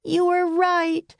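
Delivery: high, slow wail. A narrator's reading. Annie wailing to Jack about the lost puppy. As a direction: cry out in dismay. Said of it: "wailed Annie".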